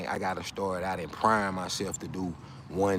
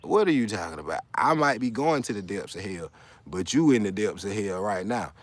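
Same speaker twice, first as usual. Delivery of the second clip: ordinary talk, nothing marked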